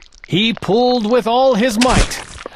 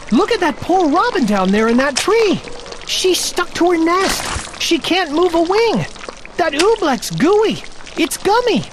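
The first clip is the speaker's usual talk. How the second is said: lightly voiced anxious boy, higher; young Bartholomew; 9 semitones higher than usual. Bartholomew tells the bell ringer about the robin, lightly voiced boy. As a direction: point at the stuck robin in alarm.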